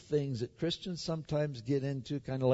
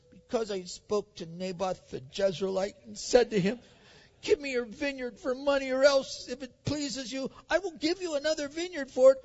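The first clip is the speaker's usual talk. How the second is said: put-on high, petulant child's voice; childish whining; 7 semitones higher than usual. Ahab complaining to his wife about the vineyard, in a mock childish whine. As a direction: whine it out like a pouting little kid. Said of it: "speak in the tone of a child".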